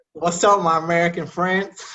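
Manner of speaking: hammed-up attempt at an American accent; fake American accent. Shown as a demonstration. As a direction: put on an American accent, playfully. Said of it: "Let me try to pull an American accent".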